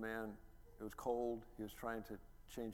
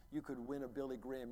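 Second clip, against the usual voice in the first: ordinary talk, nothing marked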